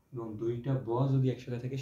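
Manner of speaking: usual speaking voice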